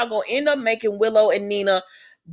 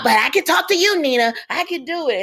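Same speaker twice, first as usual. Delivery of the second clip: higher, pleading mock of a timid girl; mocking Willow; 7 semitones higher than usual. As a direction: imitate Willow insisting she can manage, with scorn. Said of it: "I cannot stand the Willow character".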